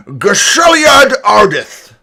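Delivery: put-on booming old orc addressing the crowd; grand proclamation, slow and loud; elderly orc announcer.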